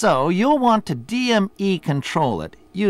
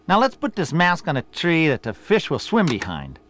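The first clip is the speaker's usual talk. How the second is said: ordinary delivery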